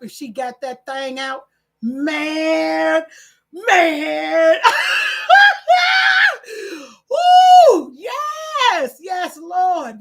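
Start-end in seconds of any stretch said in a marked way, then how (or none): 1.8-10.0 a real shout of joy that breaks into laughing and whooping; overjoyed outburst. Real, not acted. Host overwhelmed with joy, shouting and laughing.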